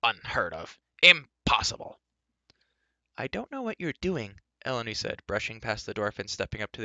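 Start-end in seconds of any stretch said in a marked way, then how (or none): 0.0-2.0 loud, indignant dwarf ranting; high and loud sputtering outrage; Dolly, outraged. A narrator's reading. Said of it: "Dolly cried". 3.2-4.4 put-on high-pitched girl character; higher, chiding character voice; Elenwy, impatient. A narrator's reading.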